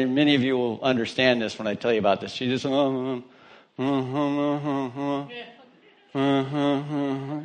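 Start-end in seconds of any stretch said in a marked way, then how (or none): 2.6-7.4 soft, slow wordless "uh" sounds; imitated dazed murmuring. Sheila coming to before her pastor, imitated quiet dazed sounds. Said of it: "she just looks at it".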